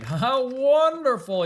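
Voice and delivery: king crying out with joy; stretched-out wonder; delighted cry. Acted. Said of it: "he cried".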